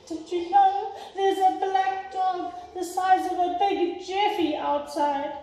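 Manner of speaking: put-on boy alarmed, telling his family; alarmed little boy. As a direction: blurt it out like a small boy. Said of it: "boy voice, alarmed".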